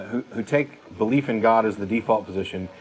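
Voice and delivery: ordinary delivery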